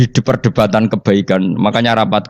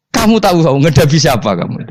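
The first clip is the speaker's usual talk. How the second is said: mock stern rebuke, pitched up with laughter; half-laughing stern challenge; Umar scolding; 8 semitones higher than usual. Umar challenges Mungkar Nakir in his grave, mock stern. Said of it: "Umar nguwatek".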